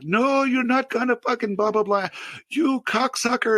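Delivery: high-pitched retold tirade; high, sustained screechy mock rage; mock screaming. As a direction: rant high, imitating an irate customer screaming. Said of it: "just screaming just horrible things".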